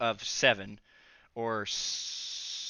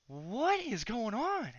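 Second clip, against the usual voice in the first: baffled question; 9 semitones higher, 3 dB quieter, much slower than usual. Retold or imitated. Asks friends, baffled.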